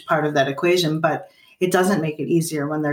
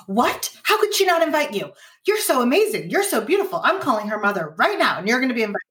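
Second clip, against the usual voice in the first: much higher, indignant gushing mom; overreacting mom; 6 semitones higher than usual. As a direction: play the mother who overreacts and takes over. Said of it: "Imagine how that would shut you down".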